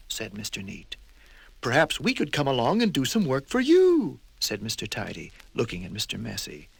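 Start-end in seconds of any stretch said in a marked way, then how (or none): 1.6-4.2 higher, louder, coaxing; polite but pushy; fussy tradesman voice; prim offer. Acted. Mister Tidy offers.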